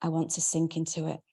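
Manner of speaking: ordinary delivery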